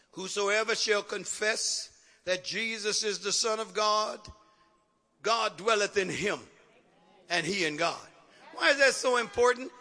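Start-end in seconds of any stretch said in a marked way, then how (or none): 0.1-8.1 slow, measured reading in a lowered pitch; unhurried Bible reading, sinking at the close; scripture reading. Real, not acted. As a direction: read the verse deliberately, pausing between phrases. Reads scripture aloud.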